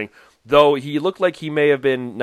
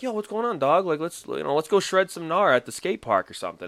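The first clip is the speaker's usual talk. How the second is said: skater dude; 5 semitones higher, 5 dB quieter than usual. Retold or imitated. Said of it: "he'd come up to me and be like".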